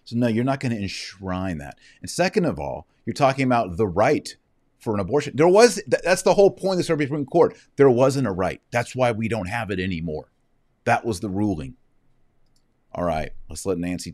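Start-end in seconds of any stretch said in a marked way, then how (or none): 5.4-8.6 loud, high, heated outburst; fired up and indignant, pushing hard; worked up. Real, not acted. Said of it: "I'm worked up".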